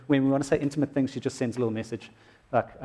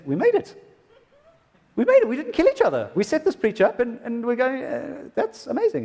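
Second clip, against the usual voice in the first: high-pitched joyful mock wife; wife's excited relief; 9 semitones higher than usual. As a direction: cheer like the wife who made it through. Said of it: "Ange said".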